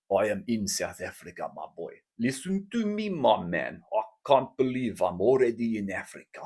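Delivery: put-on South African accent with swooping pitch. Boasting to listeners about flying to Africa, South African accent. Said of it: "that accent".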